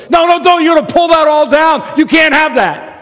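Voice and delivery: very high, loud frantic parent; frantic dad scolding. Retold or imitated. Father scolding grabbing kids at the store, frantic high loud. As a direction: stop the kids before it all falls.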